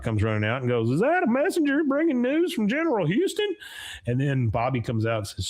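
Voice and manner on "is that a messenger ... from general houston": lightly voiced tipsy frontiersman, pitched much higher; a hint of a drunk soldier, way up high; mock drunk eagerness, high-pitched; drunk Davy Crockett. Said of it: "the drunk Davy Crockett".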